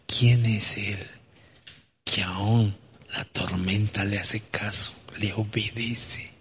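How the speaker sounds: slow, soft awed question; awed hush. Acted. Disciples murmuring in awe about Jesus. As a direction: marvel quietly at him.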